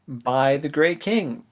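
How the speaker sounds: ordinary delivery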